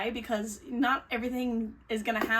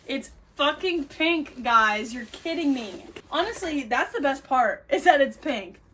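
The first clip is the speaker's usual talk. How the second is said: gushing excitement; 3 semitones higher, 6 dB louder than usual. Real, not acted. Gushing over pink pillow.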